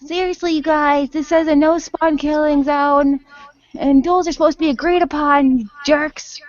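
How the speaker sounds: put-on indignant shout at the teenagers; yelling. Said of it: "He yells at the teenagers".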